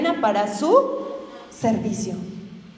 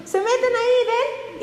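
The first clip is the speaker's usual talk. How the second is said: playful app demo; 11 semitones higher, slower than usual. Pretend. Mimicking app use.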